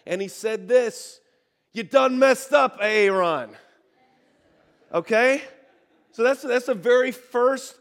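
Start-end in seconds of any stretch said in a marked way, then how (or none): 1.7-3.5 loud, exasperated scolding, drawn out; mad Moses. Retold or imitated. Moses scolding Aaron over the golden calf, hammed-up anger. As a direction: bark it at Aaron like a furious brother. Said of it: "he got really mad".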